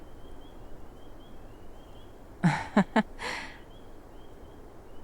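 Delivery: quiet breathy nervous laugh; a soft sheepish chuckle; awkward laugh. Acted. Embarrassed after blurting it to the partner, a nervous laugh.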